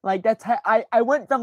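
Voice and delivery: ordinary delivery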